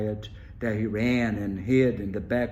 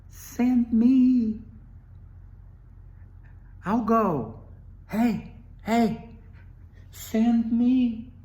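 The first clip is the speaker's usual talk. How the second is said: much higher, louder little squeak; squeaky tiny voice; 11 semitones higher, 5 dB louder, slower than usual. Acted. Tiny spider squeaking to Wolf, eager to go. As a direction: pipe up small and eager. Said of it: "squeaked the tiny voice".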